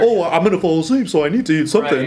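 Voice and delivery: high, loud self-excusing voice; mock rationalizing. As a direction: whine out a mock excuse to yourself.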